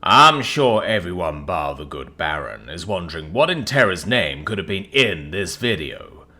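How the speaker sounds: put-on loud, regal mid-Atlantic accent; grand king in mid-Atlantic accent. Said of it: "In an affected mid-Atlantic accent".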